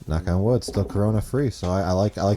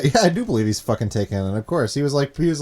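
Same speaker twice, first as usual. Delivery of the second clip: ordinary delivery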